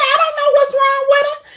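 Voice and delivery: very high-pitched retold exasperation; exasperated. Shopper agreeing with store employee, high exasperated voice. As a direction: squeal it out in mock bewilderment.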